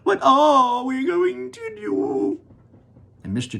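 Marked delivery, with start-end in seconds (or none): 0.0-2.4 high, slow, loud lament; the mother wails over her tiny son; wailing. Acted. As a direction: wail it in despair. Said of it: "wailed Missus T V".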